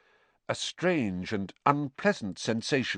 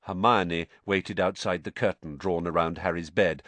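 ordinary delivery, nothing marked

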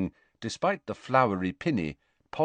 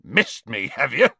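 gruff, scornful snort; dismissive, snorted question; 4 semitones higher, 4 dB louder than usual. A narrator's reading. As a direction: snort it in disbelief. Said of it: "snorted Hagrid".

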